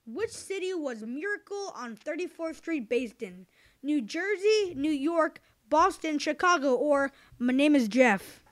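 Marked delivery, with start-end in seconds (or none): 3.8-8.4 game show host. Acted. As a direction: lively quiz host listing choices. Quiz host reading options.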